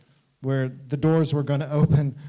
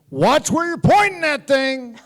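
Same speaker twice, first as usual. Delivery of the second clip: a hint of a scolding elder, higher and louder; stern father warning; 9 semitones higher, 5 dB louder than usual. Relatives scolding a boy handling a gun, mock stern warning. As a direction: bark the warning like a watchful grandfather. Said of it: "I hear my father ... going".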